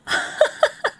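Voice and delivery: breathless giggle, almost no voice; airy laugh. Real, not acted. Laughing at chat in a breathless giggle.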